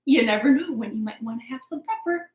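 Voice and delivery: normal talking voice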